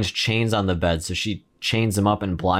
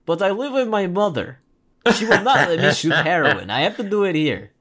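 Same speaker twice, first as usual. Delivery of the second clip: comical, higher-pitched Russian junkie voice; over-the-top Russian accent; 7 semitones higher than usual. Retold or imitated. Junkie makes excuses to John, over-the-top Russian accent. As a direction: plead like a cartoonish Russian addict. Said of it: "really over-the-top Russian accent".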